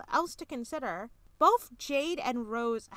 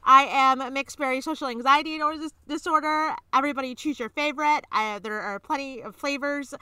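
speed review; 3 semitones higher, 10 dB louder than usual. Pretend. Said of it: "You have to speak as fast as you can".

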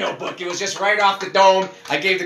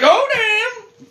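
high-pitched, drawn-out exclamation; startled reaction; 3 dB louder than usual. Retold or imitated. Host recalling the noisy closet door, startled. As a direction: let it leap way up in alarm. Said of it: "Door sounded like, I was like".

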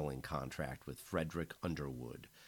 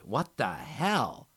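slow, louder, higher cry of shock; a shocked friend screaming at the sight; 6 semitones higher, 7 dB louder, much slower than usual. A narrator's reading. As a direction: scream at what she sees. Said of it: "She screamed".

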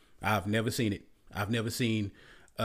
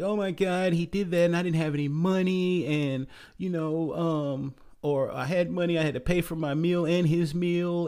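whiny victim; 5 semitones higher than usual. Retold or imitated. Crying women interviewed by police.

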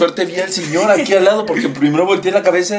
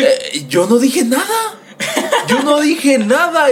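higher, slow protesting innocence; mock innocent; 11 semitones higher, slower than usual. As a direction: play dumb, drawn out and high. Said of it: "yo le dije". (fingiendo inocencia, aguda y lenta)

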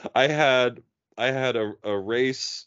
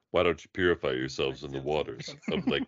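low soft mock [name]; low [name] impression; 8 semitones lower, 4 dB quieter than usual. [name] answering the guys, low smooth voice. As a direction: purr it like [name], deep and cool.

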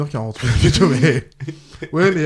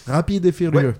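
put-on Quebec French accent; 4 semitones higher, 3 dB louder, slower than usual. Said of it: "en québécois avec un petit accent".

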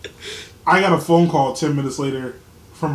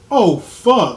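loud, higher re-enacted playground curse; retold yell; 6 semitones higher, 8 dB louder than usual. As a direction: yell it out, acting the memory. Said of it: "and I yelled".

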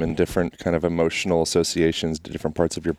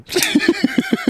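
high squealing laugh; 4 dB louder than usual. Real, not acted.

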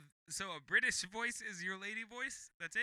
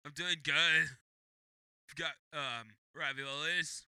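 low, congested neutral voice; a badly faked lady voice, congested; attempted lady voice; 6 semitones lower than usual. Shown as a demonstration. Demonstrating a lady voice for listeners, congested attempt. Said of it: "just congested voices".

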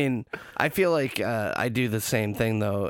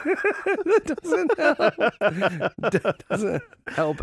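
slow, laughing through the words; 10 semitones higher, much slower than usual. Real, not acted. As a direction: crack up while saying it. Laughing at friend's line.

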